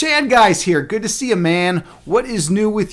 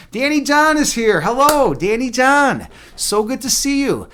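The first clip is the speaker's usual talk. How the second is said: light up at the name; excited greeting; 8 semitones higher, slower than usual. Real, not acted. Streamer welcoming Danny John into chat, delighted.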